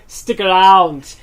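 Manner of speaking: put-on slow, loud Austrian-accented one-liner; Arnold's Austrian accent. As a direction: punch it out slowly in a thick Austrian accent. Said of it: "when he said it with that accent".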